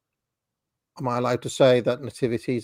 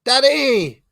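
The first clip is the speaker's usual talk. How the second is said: put-on high, loud child's call of "Daddy"; daughter calling out; 11 semitones higher, 6 dB louder than usual. Child on stage spotting a parent in the audience, excited high call. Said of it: "she was like".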